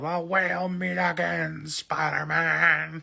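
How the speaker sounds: high, very slow villain call; hammed-up villain threat, every word stretched; Green Goblin. Villain taunting his enemy, drawn-out and theatrical. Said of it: "the Green Goblin's like".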